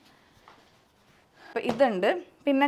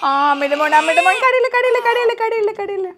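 very high loud sing-song soothing; 10 semitones higher, 10 dB louder than usual. Real, not acted. Soothing crying baby.

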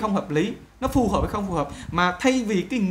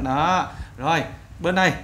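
ordinary delivery

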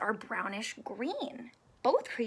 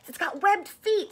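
ordinary delivery, nothing marked

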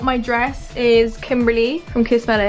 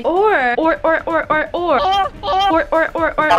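seal barking; 4 semitones higher, slower than usual. Pretend. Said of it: "What are you, a fucking seal?".

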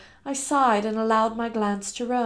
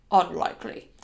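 Lane's voice; 5 semitones lower, 3 dB louder than usual. Acted. Lane voiced in narration.

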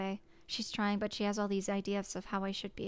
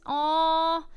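delighted aw; 7 semitones higher, 9 dB louder than usual. Real, not acted. Touched by a friend's plan.

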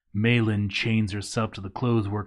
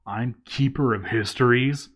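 usual speaking voice

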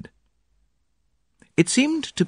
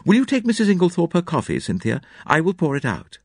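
lady of the house voice; 8 semitones higher than usual. A narrator's reading. Mary voiced in story.

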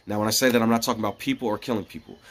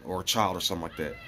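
normal talking voice

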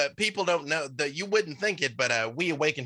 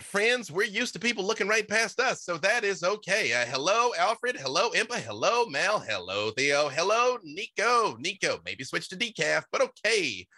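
a higher, friendly talking bush; awakened shrub; 6 semitones higher than usual. Acted. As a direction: play the cheerful shrub greeting everyone.

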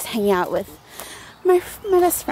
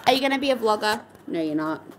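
ordinary talk, nothing marked

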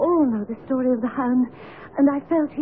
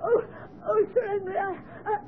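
higher, sobbing, stammered excuse; tearful fluster; 4 semitones higher, 3 dB quieter than usual. Acted. Weeping servant explaining herself to Sir Henry, tearful. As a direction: caught out and trying not to cry.

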